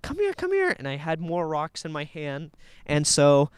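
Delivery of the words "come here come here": high squeaky "come here" to a dog; dog-calling voice. Retold or imitated. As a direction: coax a dog in a high sing-song. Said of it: "make, like".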